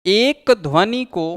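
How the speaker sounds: teacher dictating notes, one phrase at a time; dictation pace. Shown as a demonstration. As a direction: read it out phrase by phrase for students to write down. Dictating the definition of Sangam.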